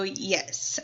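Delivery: ordinary talk, nothing marked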